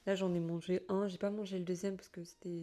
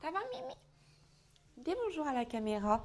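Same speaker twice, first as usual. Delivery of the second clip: pet talk; 8 semitones higher, 4 dB louder than usual. Real, not acted. Talking sweetly to her cat.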